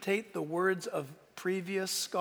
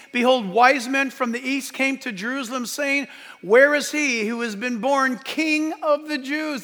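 loud, higher-pitched pulpit reading building to a shout; raised preacher's voice; forceful preaching; 6 semitones higher, 10 dB louder than usual. Acted. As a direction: proclaim the scripture, then drive the point home hard.

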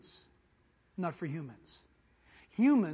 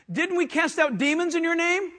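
higher, loud indignant plea; loud pleading; 8 semitones higher, 9 dB louder than usual. Acted. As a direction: protest desperately at the gate. Pleaders at judgment.

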